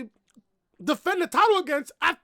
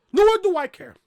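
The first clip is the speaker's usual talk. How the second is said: louder emphatic repeat; 4 semitones higher, 7 dB louder than usual. Real, not acted.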